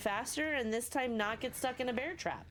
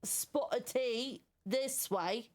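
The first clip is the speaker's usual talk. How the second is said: hammed-up Cockney, pitched higher; mock Cockney accent; 5 semitones higher, slower than usual. Gamer mocking the merchant's voice, exaggerated Cockney accent. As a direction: put on a silly Cockney caricature. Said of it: "have a Cockney accent".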